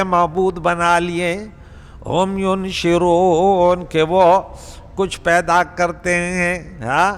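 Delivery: slow, measured verse recitation with translation; Quran recitation. Acted. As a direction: recite and translate phrase by phrase, drawn out. (ٹھہر ٹھہر کر تلاوت اور ترجمہ)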